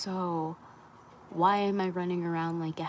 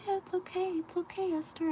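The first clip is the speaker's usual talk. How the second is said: much higher, tender reassurance to herself; gentle inner voice; 12 semitones higher than usual. Retold or imitated. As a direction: coo it to yourself like comforting a child. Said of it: "a big part of me is like".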